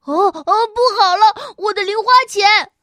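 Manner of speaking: high, loud panic over lost money; panicked cry. Acted. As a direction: cry out in alarm. Money spills everywhere.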